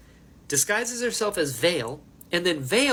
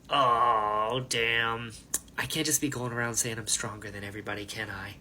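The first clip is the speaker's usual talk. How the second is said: low mock groan trailing off quieter; low-pitched, fading character quote; lightly acted Dalinar; 6 semitones lower, 5 dB quieter than usual. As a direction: play the hero lightly, low and subdued. Acts Dalinar after healing himself.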